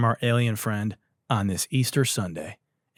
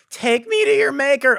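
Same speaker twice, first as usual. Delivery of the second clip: high, alien voice mocking the clip; hammed-up alien impression; 13 semitones higher, 4 dB louder than usual. Podcaster mocking an alien guest's voice, high and loud. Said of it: "do you really sound like that".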